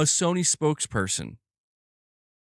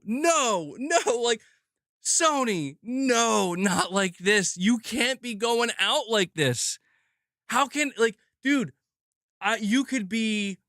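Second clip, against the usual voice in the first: real, higher-pitched drawn-out refusals; anguished protest; 4 semitones higher, slower than usual. Real, not acted. Fan begging Sony not to end it like this, anguished protest. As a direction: plead against it, stretching the words.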